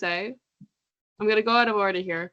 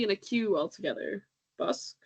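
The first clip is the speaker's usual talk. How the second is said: ordinary talk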